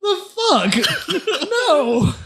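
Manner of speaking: high-pitched, laughing disbelief; incredulous refusal. Acted. Player answering a request to scale the wall, incredulous high refusal. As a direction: balk at it in a high voice. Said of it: "I look at him with such just".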